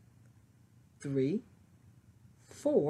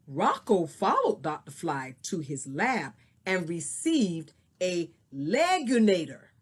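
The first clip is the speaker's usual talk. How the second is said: lively storybook reading; story narration; 4 semitones higher than usual. Acted. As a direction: read it like a picture book to kids. Reads a picture book aloud.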